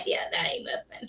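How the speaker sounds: breathy half-whispered aside; confiding whispered remark. Real, not acted. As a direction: drop to a whisper for the punchline. Aside on sleep deprivation.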